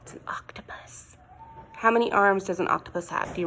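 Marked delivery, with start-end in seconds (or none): 0.0-1.2 hushed reveal whisper. Acted. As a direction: whisper it like a secret. Reveal to children.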